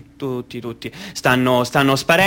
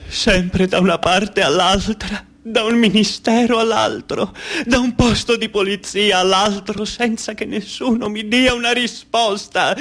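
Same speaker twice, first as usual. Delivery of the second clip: desperate crying mother; 9 semitones higher than usual. Acted. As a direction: wail like a mother in a radio melodrama. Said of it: "E ora un tipico radiogram-, radiodramma argentino". (madre disperata che piange, voce alta e lenta)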